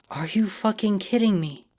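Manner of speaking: high, incredulous outburst; shocked outburst. A narrator's reading. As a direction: she can't believe what she just heard. Rosie shocked at the name.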